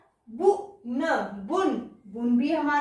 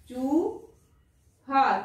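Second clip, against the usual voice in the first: drawn out slowly with a long ऊ; slow sounding out; much slower than usual. Shown as a demonstration. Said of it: "teacher sounds out word".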